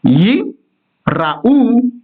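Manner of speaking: Yi, a stop, then raou stressed on ou; Yi... ra-OU, with a full ra syllable; pause, stress on ou. Shown as a demonstration. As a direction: break after Yi and sound raou as ra-OU. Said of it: "on va accentuer donc au ou".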